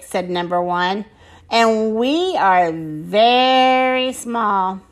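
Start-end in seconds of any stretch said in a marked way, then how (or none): 1.5-4.8 very slow, with VERY stretched long; drawn-out emphasis. Acted. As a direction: dwell on how VERY small you are. Tiny alien stresses smallness.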